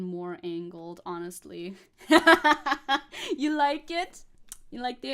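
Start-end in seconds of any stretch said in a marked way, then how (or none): 2.0-3.3 high, loud cackling laugh; a long burst of high laughter. Real, not acted. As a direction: crack up out loud. Amused by chat about accent.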